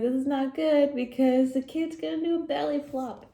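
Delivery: a penguin bracing for a splash; worried penguin. Acted. Penguin in the pool warning about a belly flop, fretting. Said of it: "the penguin's like".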